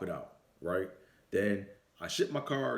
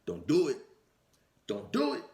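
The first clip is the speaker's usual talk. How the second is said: loud, rising Don't do it, going higher; mock warning; 6 semitones higher, 4 dB louder than usual. Coworker John warning him off the car, loud rising. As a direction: belt out the warning, pitch climbing on the second. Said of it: "And John just goes".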